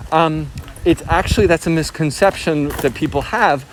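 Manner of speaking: normal talking voice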